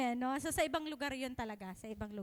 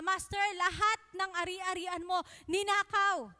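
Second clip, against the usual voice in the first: frantic servant; 4 semitones higher, 5 dB louder than usual. Acted. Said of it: "mag-report yung kanyang alipin".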